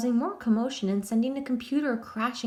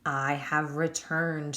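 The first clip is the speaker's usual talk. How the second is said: slow, lower croaked ghost voice; ghostly croak; 5 semitones lower, slower than usual. A narrator's reading. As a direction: croak like a returning spirit. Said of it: "Sabrina croaked".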